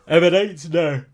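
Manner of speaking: said through a yawn. Real, not acted. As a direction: yawn the word out. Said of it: "Sorry, I'm yawning".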